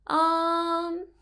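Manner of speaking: "um" held high and level; high flat tone. Shown as a demonstration. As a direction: stretch "um" on one high, unbending note. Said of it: "we use a similar tone when we say".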